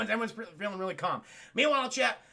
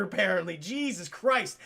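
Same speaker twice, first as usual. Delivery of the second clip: ordinary talk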